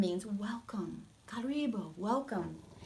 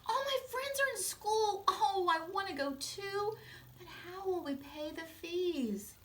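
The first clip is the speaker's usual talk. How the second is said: put-on high child voice, yearning; young boy longing; 11 semitones higher than usual. George begging his mother to attend school, high. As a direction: plead like a boy who wants school. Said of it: "he said".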